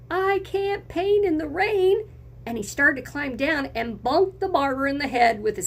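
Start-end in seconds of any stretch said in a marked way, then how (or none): 0.1-2.1 high, complaining painter; a hammed-up grumble from the ladder; painter grumbling. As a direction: painter griping about the rain. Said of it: "the painter grumbled".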